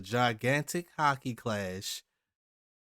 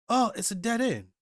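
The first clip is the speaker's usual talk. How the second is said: surprised cry; 9 semitones higher, 4 dB louder than usual. Retold or imitated. Character surprised at dead end.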